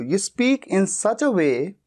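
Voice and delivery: very slow, each word spelled out for students; slow dictation. Shown as a demonstration. Teacher slowly dictating the English sentence to learners. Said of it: "इस तरह से बोलते हो".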